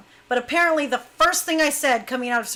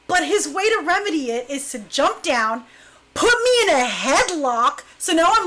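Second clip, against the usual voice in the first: normal talking voice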